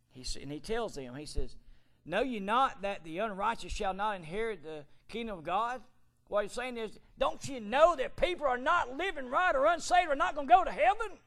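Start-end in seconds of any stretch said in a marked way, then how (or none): 2.0-11.2 high, loud fire-and-brimstone preaching; full-force preaching. Acted. Preacher thundering Paul's warning at the church, loud and high. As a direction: thunder the scripture at the congregation.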